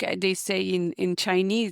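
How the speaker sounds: ordinary talk, nothing marked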